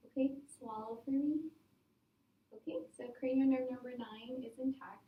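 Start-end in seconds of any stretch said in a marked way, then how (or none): none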